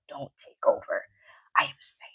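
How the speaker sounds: whispered self-talk. Shown as a demonstration. Whispered reminder to yourself before an angry talk. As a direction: breathe it out to yourself. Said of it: "what you have to whisper to yourself".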